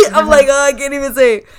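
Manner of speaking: high, loud, laughing admission; helpless laughing outburst; laughing at oneself. Real, not acted. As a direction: crack up while owning the failure. Laughing at failing the long word.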